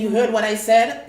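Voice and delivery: loud, sharp rebuke; stern tone. Retold or imitated. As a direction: shut the patient down. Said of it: "You know, in this tone".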